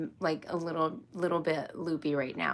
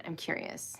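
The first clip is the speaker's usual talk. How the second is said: soft whisper of I'm curious; whispered repeat; 9 dB quieter than usual. Real, not acted. Repeating her question to herself, whispered. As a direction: murmur it to yourself.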